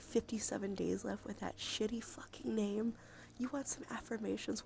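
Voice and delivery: ordinary talk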